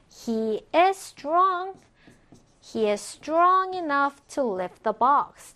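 slow, careful teacher's English; drawn-out, evenly spaced words; slow model reading. Shown as a demonstration. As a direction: read it slowly for learners to follow. Teacher models English example sentence.

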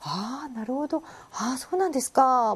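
a hammed-up stream of agreement; exaggerated nodding along. Shown as a demonstration. A Japanese listener exaggerating agreement, slowly drawn. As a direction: overdo the listener responses. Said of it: "막 이렇게 한단 말이야".

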